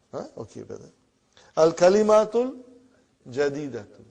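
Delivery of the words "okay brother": ordinary delivery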